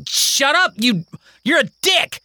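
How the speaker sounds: put-on high, angry kid cursing; high child's fury. Kingston cursing at Mary, high and angry. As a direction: lash out at her. Kids fight and curse.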